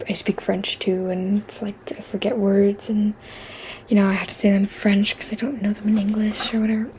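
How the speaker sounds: soft whisper, confiding; whispered aside. Real, not acted. Whispering to viewers about forgetting English words. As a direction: let it slip out in a whisper.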